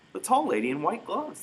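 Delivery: put-on high, innocent small girl's voice; a tiny sister telling her mother plainly; little girl. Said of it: "the little sister said".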